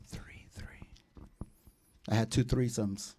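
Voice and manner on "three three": mystical whisper. Acted.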